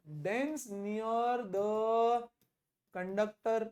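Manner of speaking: very slow, drawn-out dictation; word by word for copying; dictating notes. Real, not acted. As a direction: stretch each word for note-takers.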